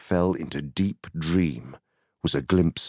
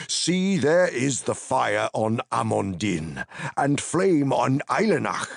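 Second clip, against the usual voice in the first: raised, urgent wizard pointing out beacons; Gandalf's urgent voice; 6 semitones higher than usual. Acted. Gandalf urgently showing Pippin the lit beacons, raised and eager. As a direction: call out the beacons with urgent wonder.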